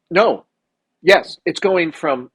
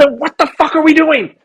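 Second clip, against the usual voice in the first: high, louder disbelieving rant; incredulous shout, pitched way up; exasperated outburst; 10 semitones higher, 4 dB louder than usual. Real, not acted. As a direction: throw your hands up in outrage. Outraged rant about Omicron.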